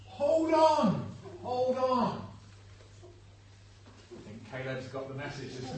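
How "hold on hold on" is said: loud, high rallying yell; full-voiced cheering call; spectator's shout. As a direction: bellow encouragement from the trackside. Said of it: "We might shout".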